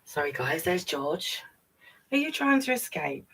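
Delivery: ordinary delivery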